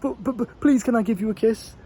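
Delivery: high, stammering ask; nervous pleading. Pretend. Timid guy asking a girl, nervous pleading. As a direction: beg timidly for the kiss. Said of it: "absolutely terrified".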